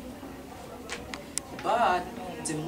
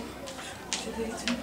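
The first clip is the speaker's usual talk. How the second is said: breathless, slow whispered panic; desperate whisper; 13 dB quieter than usual. Acted. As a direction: whisper it to yourself, lost. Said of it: "Not knowing what to do".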